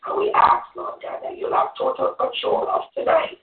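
fervent, earnest congregational prayer; closing prayer. Real, not acted. Woman closing the Bible study in prayer, earnest prayer. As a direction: pray it aloud, calling on God phrase by phrase.

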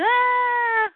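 a sudden high cry, hammed-up; high mock yelp. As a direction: yelp as if grabbed. Retelling, character yells.

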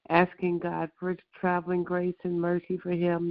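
ordinary talk, nothing marked

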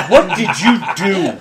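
drawn-out in-character demand; accusing. Acted. Confronting the kid in the boat, accusing. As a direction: stretch it out, aghast at the kid.

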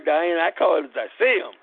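ordinary delivery, nothing marked

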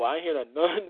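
ordinary delivery

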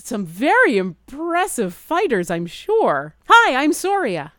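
bright, loud and high, showing off her charm; the bird bard greeting the impressive fighters, loud and eager. Acted.

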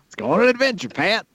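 high twangy robot voice; a movie robot cheerfully inviting his pal along. Retold or imitated. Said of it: "in my impression of L".

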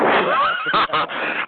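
sudden high burst of laughter; real laugh. Real, not acted. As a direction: crack up at the news. Laughs at rival being mocked.

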